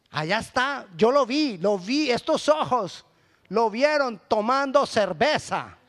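loud, high indignant tattling; a hammed-up busybody shouting what he saw; accusing gossip. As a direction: point the finger and tell everyone. Gossiping brother accusing pastor.

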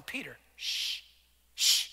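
a quick hushing aside; mock hush. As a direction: shush him under your breath. Someone hushing Peter.

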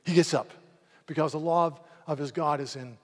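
loud, driving sermon climax; impassioned loud preaching; full-force preaching. Real, not acted. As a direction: preach it with fire to the congregation.

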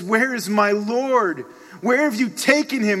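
loud, high pleading cry; anguished demand. Acted. Grieving Mary to supposed gardener, loud anguished demand.

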